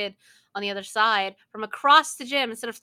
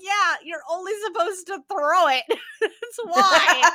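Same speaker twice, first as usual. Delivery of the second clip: higher, with a laugh; laughing retort; 7 semitones higher, 7 dB louder than usual. Retold or imitated. Answering the sorry kid, laughing sarcastically. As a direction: tease him, laughing. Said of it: "And I'm like".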